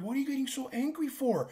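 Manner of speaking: high, soft, fast puzzled question; bewildered servers. Retold or imitated. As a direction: ask it like you can't see the problem. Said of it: "they all look at me so bewildered".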